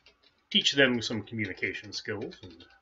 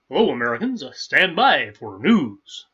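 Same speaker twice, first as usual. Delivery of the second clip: Paul Harvey; 5 semitones higher, 13 dB louder than usual. Retold or imitated. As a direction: announce it grandly like a vintage radio news host. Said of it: "that was Paul Harvey".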